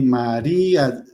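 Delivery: "María" stretched slowly with weight on the í; stress on the Í. Shown as a demonstration. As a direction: lean long on the í in "María". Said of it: "la fuerza de la voz recayó en la".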